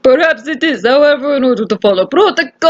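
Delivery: officious inspector in an attempted Russian accent; thick-ish mock Russian, procedural. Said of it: "I love this accent!".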